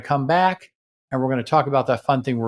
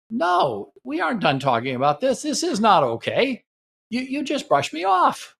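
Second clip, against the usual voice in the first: higher, pleading insistence; upset protest; 11 semitones higher than usual. Shown as a demonstration. Partner protesting to spouse, upset and insistent. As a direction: push back hard against being brushed off.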